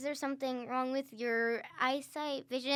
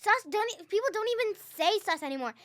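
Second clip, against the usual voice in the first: annoyed; 6 semitones higher, 9 dB louder than usual. Real, not acted. Sibling quarrel over slang.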